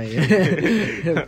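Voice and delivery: yeah through a laugh. Real, not acted. Laughing at the nuts joke, a laughing yeah. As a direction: say it while laughing.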